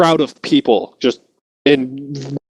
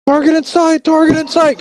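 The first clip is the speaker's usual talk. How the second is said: gunner calling out. Retold or imitated. Gunner in turret calling target to crew, urgent shout. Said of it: "Coming from the turret above".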